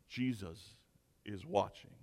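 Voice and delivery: understated eerie voice from over the shoulder; ominous unseen voice. Acted. Unseen voice to a burglar mid-break-in, quietly ominous.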